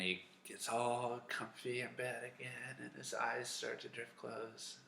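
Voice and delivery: soft sleepy narration; drowsy, cozy. A narrator's reading. Storyteller settling Samuel back into bed, softly drowsy.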